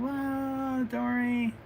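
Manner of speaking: high, stretched-out character cry; acted fall reaction. Acts out characters during her fall.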